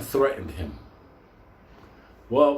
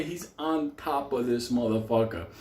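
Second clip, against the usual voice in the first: ordinary talk, nothing marked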